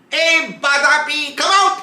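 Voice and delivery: high, loud call to his brother; shouting out. Retold or imitated. Atapi calls his brother out of the sage's belly, shouting. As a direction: yell him out, voice way up. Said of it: "He shouted".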